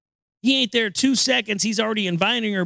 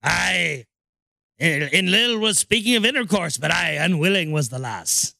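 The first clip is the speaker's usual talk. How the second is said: mock Irish brogue, lower; mock Irish pirate; 4 semitones lower than usual. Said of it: "drunkard and Irishman".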